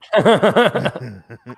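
bursting out laughing; amused laugh at the joke; real laugh. Real, not acted. Laughing at a friend's German accent joke. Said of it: "That sounds like a German accent".

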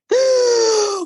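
high, sharp intake of breath; shocked gasp. Retold or imitated. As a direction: gasp as the news sinks in. Learning outlets are American.

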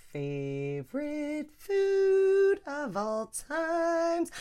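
stretched, high-held words; drawn-out declaration. Real, not acted. Declaring a favorite food to listeners, drawn out.

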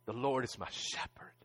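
soft unvoiced whisper; whispered prayer. Preacher recalling his prayer at grandma's house, whispered. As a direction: breathe the words as a private prayer. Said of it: "I called out to Jehovah Rohi".

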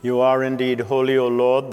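usual speaking voice

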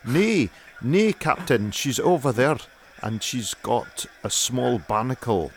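showing off a Scottish accent. Shown as a demonstration. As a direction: do a hammed-up Scottish sailor reporting to the captain. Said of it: "do your Scottish that you do in the show".

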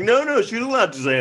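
high-pitched mock protest; hammed-up pleading objection; arguing. Lawyer arguing with the judge, mock protesting. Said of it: "And then she'll argue".

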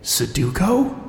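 unsteady, hushed question; faltering question. Acted. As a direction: let the word falter. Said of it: "The word faltered as it passed my lips".

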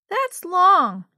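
put-on high, loud child's complaint; complaining little sister. A narrator's reading. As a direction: grumble loudly like a small child. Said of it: "Yo-Yo complained".

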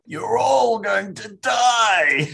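high, slow bellowed threat; drawn-out mock battle shout; film quote shout. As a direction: roar it at the troops like a soldier in the film. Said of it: "memorable line".

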